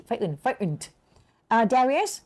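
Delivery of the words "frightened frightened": "frightened" repeated without the middle T; T dropped. Shown as a demonstration. Teacher repeats a variant for students, middle T dropped in "frightened". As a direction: leave the middle T silent in "frightened". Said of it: "ไม่มีตัว T".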